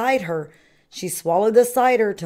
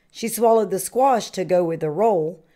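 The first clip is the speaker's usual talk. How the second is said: plain reading voice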